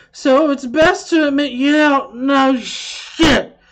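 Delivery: high, loud, slow slurring rant; drunk voice. Acted. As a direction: read it like a drunk yelling at you. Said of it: "I love reading those particular comments in a drunk voice".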